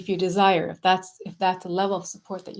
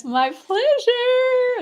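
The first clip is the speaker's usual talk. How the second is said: airy goddess voice, much higher and slow; high sing-song goddess reply; 5 dB louder, much slower than usual. Pretend. Answering as the goddess.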